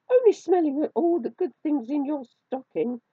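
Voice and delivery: high, loud squeaky mouse; high-pitched storybook mouse; little mouse voice. A narrator's reading. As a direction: pipe up high as a caught mouse. Narrator voices the caught mouse.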